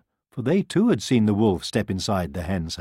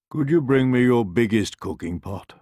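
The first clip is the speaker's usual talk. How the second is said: wolf voice; 3 semitones higher, 5 dB louder than usual. A narrator's reading. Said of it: "he asked".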